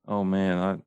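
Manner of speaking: ordinary talk, nothing marked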